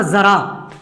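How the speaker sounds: जरा said with a z sound, ज़रा; ज़रा, nukta z not j. Shown as a demonstration. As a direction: say जरा as zara with a buzzing z. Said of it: "इस जा पर नुक्ता लगा दूं".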